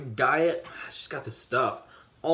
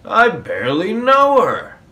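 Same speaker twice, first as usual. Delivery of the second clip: punchy, loud and high; husband's loud, high comeback; loud punchline; 8 semitones higher, 9 dB louder than usual. Acted. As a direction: blurt the punchline out.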